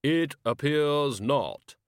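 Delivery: very slow, booming, grave voice; Death's voice. Acted. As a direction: drag each word out like a tomb.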